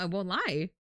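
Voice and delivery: ordinary delivery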